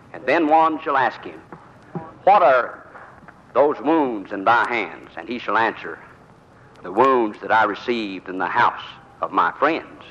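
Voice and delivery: slow, hushed reading of "What are those wounds in thy hands"; solemn scripture reading. Acted. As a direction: read the verse slowly like a preacher. Recites Bible verse slowly.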